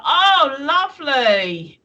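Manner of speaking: drawn-out, swooping sarcastic "lovely"; sarcastic delight. Real, not acted. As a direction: stretch it out in mock appreciation. Sarcastic reaction to a sight.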